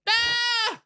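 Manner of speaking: high loud scream of fright; startled yelp. Acted. Found hiding under the desk, startled scream. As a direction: shriek at being discovered.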